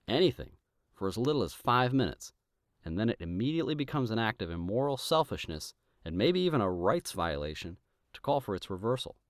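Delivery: ordinary talk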